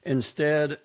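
ordinary delivery, nothing marked

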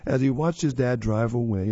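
ordinary delivery, nothing marked

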